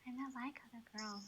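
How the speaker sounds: breathy whisper of the cliché; whispered mock. Friend echoes the cliché back in a whisper. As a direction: hush the line like a secret.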